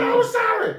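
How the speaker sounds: very high falsetto yell of sorry; hammed-up girlfriend shrieking an apology; mock girlfriend yelling high; shouted apology.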